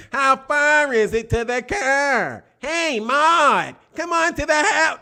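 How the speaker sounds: hammed-up Chicago accent calling out. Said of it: "this is what Chicago people sounded like".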